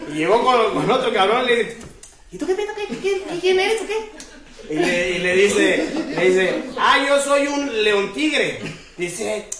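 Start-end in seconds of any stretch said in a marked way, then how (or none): 2.3-4.1 pinched, much higher mock critter voice; animal in the joke. 6.8-8.6 slow, higher, proud drawl; liger in the joke. Acted.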